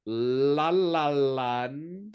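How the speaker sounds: very slow, sung-out title; drawn out. Real, not acted. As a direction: stretch each syllable lovingly.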